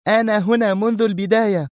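very high, sharp retort; high-voiced indignant protest. A narrator's reading. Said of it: "قالت في حدة".

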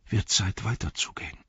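soft breathy whisper fading out; whispered wake-up. A narrator's reading. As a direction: whisper it close to his ear. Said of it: "flüsterte ihm ins Ohr".

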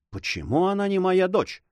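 ordinary delivery, nothing marked

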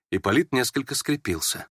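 ordinary delivery, nothing marked

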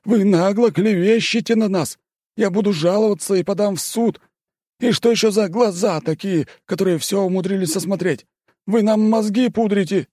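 high, loud indignant tirade; outraged yelling. Acted. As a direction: rant furiously at the accuser.

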